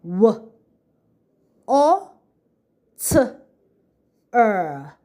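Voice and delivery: water sounded out as W, O, T, R, the A said like O; sounding out. Shown as a demonstration. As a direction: say each sound of water apart. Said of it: "What are the sounds in the word water?".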